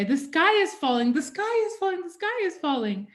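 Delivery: hammed-up scared chicken voice; Chicken Little panic. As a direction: squawk it like a frightened chick. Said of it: "Chicken Little would always say".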